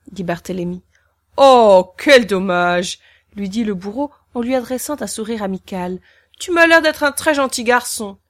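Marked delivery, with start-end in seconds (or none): 1.4-3.0 loud, slow theatrical pity; milk the fake sorrow; mock dismay. Friendly executioner pitying the boy, hamming it up. 6.4-8.2 higher, louder cheerful brute; jolly executioner. Acted. Executioner praising the boy, cheerful.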